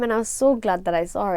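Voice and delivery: ordinary talk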